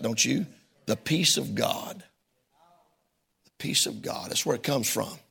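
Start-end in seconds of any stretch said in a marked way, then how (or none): 0.9-2.0 hushed awe. Real, not acted. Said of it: "I just like the sound of that". 3.4-4.3 breathy whisper, slowed; whispered awe. Real, not acted. Pastor savoring the verse, whispered. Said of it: "I just like the sound of that".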